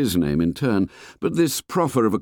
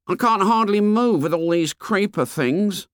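put-on fat boy voice; 12 semitones higher than usual. A narrator's reading. Fat boy complains to the fair boy, high and whiny. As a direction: complain like a chubby schoolboy.

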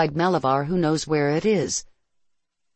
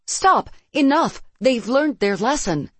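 high, raised cry to stop; urgent protest; 8 semitones higher, 4 dB louder than usual. A narrator's reading. Narrator begs Clarissa's son to stop firing, urgent protest. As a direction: call out to make them stop.

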